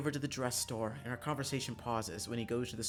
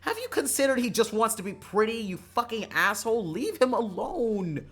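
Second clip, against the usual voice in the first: high, loud, furious yell; outraged shouting; 11 semitones higher, 10 dB louder than usual. Acted. Shouting at someone to let him be pretty, outraged. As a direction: yell it at the top, stretching the final words. Shouted defence of Karma's dresses.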